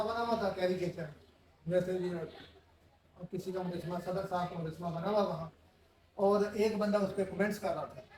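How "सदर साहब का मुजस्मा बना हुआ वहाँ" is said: ordinary talk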